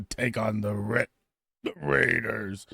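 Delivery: slow, hiccupping through the words; words broken by hiccups. Real, not acted. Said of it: "I got the hiccups at the wrong time".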